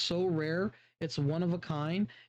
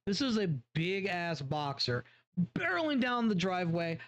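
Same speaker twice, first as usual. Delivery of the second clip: ordinary delivery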